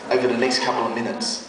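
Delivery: preacher building suspense about life-changing words; solemn build-up. A narrator's reading. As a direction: hush it for the big moment.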